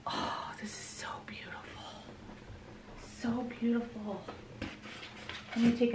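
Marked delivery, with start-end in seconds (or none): 0.1-4.2 soft, breathed-out wonder; hushed awe. Real, not acted. Woman admiring a gift from a viewer, hushed awe. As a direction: marvel under your breath. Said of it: "I'm in awe".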